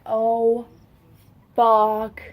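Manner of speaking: loud, drawn-out refusal, each word set apart; pissed-off outburst. Retold or imitated. Sister reacting after her brother spit in her face, pissed off. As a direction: retell it with mounting outrage, landing very loud on the last word. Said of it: "I got pissed".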